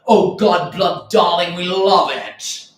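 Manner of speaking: loud, hammed-up gushing, drawn out at the end; mock adoring exclamation, slowing down; gushing socialite. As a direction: gush like a delighted scenester. Acts out gushing crowd at bars.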